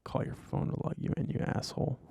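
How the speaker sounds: whispered mocking aside under breath; muttered whisper. Real, not acted. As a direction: mutter it, just for yourself.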